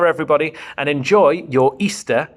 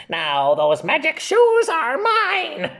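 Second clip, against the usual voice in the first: high, slow gloating witch voice; wicked witch; 9 semitones higher, slower than usual. Acted. The Wicked Witch claiming the magic shoes, slow high gloat. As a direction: savour every word like a greedy witch.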